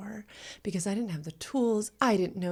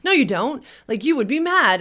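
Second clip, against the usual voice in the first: louder, much higher contradicting voice; retold heated retort; 9 semitones higher, 11 dB louder than usual. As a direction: re-enact arguing back, high and insistent. Retold argument with her partner.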